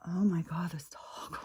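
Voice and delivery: soft unvoiced oh my God; whispered exasperation. Real, not acted. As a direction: breathe it out in disbelief. Exasperated aside.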